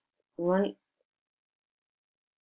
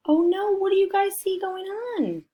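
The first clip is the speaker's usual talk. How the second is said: excited alarm; 14 semitones higher, 3 dB louder than usual. Real, not acted. Reading to children, the dinosaur escapes, excited higher voice.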